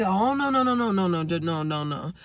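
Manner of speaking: rapid string of protests, starting high; retold alarmed pleading. Jailed friend stopping her calling his cousin, alarmed protest. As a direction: blurt out mock-alarmed objections, settling as they go. Caller begging her not to call.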